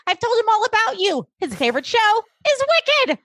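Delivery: Cyrus sebagai ayah yang bangga, suara jauh lebih tinggi; imitating Cyrus. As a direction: pamer anak dengan nada tinggi dan manis. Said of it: "And Cyrus is like".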